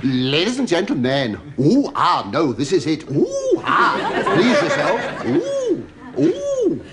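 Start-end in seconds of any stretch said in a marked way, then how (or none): 3.1-3.6 very high camp falsetto ooh. Acted. Comic squeals a high ooh at the audience. 5.3-6.8 very high held camp ooh; falsetto ooh. Acted. Audience prompts, comic answers with a very high ooh. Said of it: "Try a higher one".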